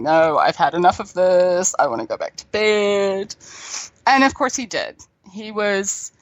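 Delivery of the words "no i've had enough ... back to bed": fed-up, half-asleep drone; imitated lethargic teenager. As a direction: mimic a sleepy fifteen-year-old who's had enough. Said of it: "have this fifteen-year-old going".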